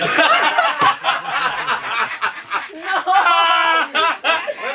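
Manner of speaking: high, loud rolling laughter; loud long laugh. Real, not acted. Host cracking up at the punchline, long loud laugh. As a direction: let the laugh run on.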